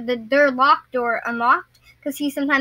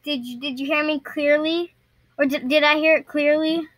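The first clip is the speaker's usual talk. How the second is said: ordinary talk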